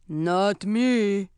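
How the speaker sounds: oafish troll denying blame. Acted. Troll passing the blame, oafish troll voice. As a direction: answer like a troll. Second troll answers in story.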